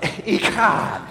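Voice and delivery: Echad with a phlegm-clearing CH; throaty CH. Shown as a demonstration. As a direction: scrape the CH in Echad hard. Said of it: "Don't spit on anybody nearby".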